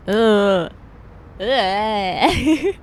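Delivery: two loud groans of protest; disgusted groan. Acted. Accused client reacting, with loud disgusted groans. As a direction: groan it out, offended.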